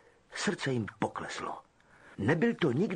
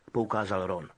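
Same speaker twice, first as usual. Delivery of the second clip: plain reading voice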